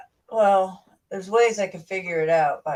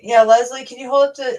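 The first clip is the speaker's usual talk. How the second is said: louder, higher call to Leslie; 6 semitones higher, 8 dB louder than usual. Real, not acted. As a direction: call across the room. Calling to co-host.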